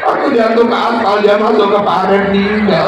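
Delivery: high, loud mock lament; hammed-up wailing tale. Widow wailing to mourners how her husband died. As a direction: wail the whole story like a grieving widow. Widow wails the accident story.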